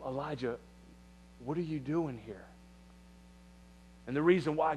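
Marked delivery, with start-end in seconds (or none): 0.1-2.4 very soft, low voice of God; hushed and low, a still small voice; quiet whisper. Acted. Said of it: "quiet whisper".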